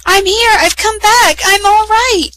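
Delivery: high, loud joyful shout. A narrator's reading. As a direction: call out to the others down the passage. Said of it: "she shouted".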